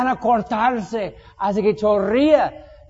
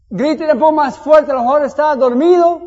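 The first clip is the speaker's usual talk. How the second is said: burla de Elías; 5 semitones higher, 7 dB louder than usual. Acted. Elías se burla de los profetas.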